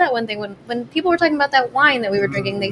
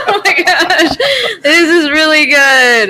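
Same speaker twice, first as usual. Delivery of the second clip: slow, laughing, raised delight; savoring delicious food; 7 semitones higher, 5 dB louder, slower than usual. Real, not acted. Savoring the beer.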